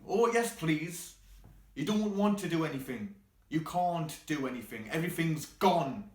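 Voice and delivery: exasperated, sarcastic rant. Acted. Mocking useless suggestions, mounting despair.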